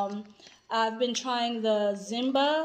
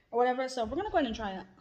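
normal talking voice

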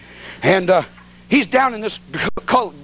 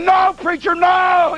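high yelled refusal; mock shout; 9 semitones higher, much slower than usual. Herman refusing the preacher's plea, high yelled no. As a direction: holler back no, amused.

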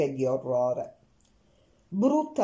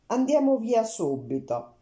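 a hurried urge to leave, pitched higher; 6 semitones higher than usual. A narrator's reading. To her friend, keen to leave at once, hurried. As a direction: rush it out, eager to get away. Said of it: "dissi in fretta".

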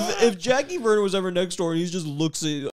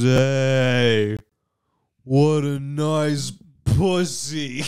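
put-on slow, drawn-out drunken leer; much slower than usual. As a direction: drag it out like a wasted creep. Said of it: "he says it so hard".

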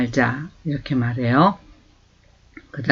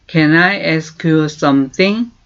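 very slow, clear English for learners to copy; slow model phrase; much slower than usual. Shown as a demonstration. Teacher slowly modelling a question. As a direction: stretch each word for repeating. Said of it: "다시 한번".

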